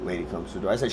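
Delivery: ordinary delivery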